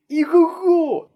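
long high whinny, drawn out; horse neigh. A narrator's reading. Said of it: "Так голосисto заіржав він".

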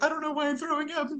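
high-pitched, hammed-up wail; mock crying. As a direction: plead through exaggerated fake tears. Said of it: "I started crying".

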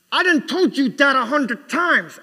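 loud, high, slow mock outburst; exploding dad. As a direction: blow up like an exasperated father. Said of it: "your head explodes and you're frothing in the mouth".

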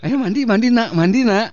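put-on mother coaxing her child to the bath; mock mother. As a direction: coax a child to bathe.

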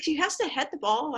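high-pitched mock complaint; retold protest. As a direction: imitate someone appealing to the referee.

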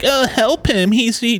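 high whiny mock plea; mocking girlfriend. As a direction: mimic her begging for help. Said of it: "His dumb bitch girlfriend's like".

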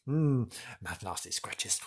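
ordinary talk

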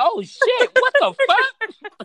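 high, loud startled yelp; shrieking in total shock; shocked outburst. Retold or imitated. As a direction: jump out of your skin in fright.

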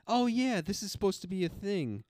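high excited fan voice; excited mock fan. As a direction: gush like an eager fan. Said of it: "you're like".

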